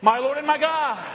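high, fervent call to join in; rallying proclamation. Real, not acted. Preacher leading the congregation in Thomas's words, fervent. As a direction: lead the room in crying it out. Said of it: "Say it with me".

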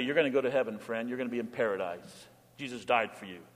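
soft, gentle comfort at a bedside; gentle reassurance. Pretend. Pastor comforting a dying friend at the bedside, gently and softly.

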